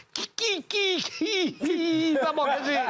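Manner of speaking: high, slow, stuck on qui; stuttering plea. Acted. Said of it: "un mec qui bégaye".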